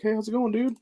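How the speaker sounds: a light take on the teacher's voicemail; imitating her message. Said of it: "being like".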